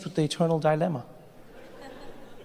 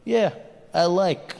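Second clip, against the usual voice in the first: terse mock Russian accent; a Russian who just takes it; slower than usual. Comic imitating Russia shrugging off a land grab in Russian accent. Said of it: "the Russians were just like".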